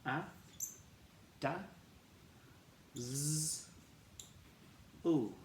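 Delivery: d, a, da, z, l said apart; sounding out dazzle. Shown as a demonstration. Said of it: "And we say d".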